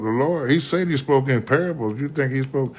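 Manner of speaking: ordinary delivery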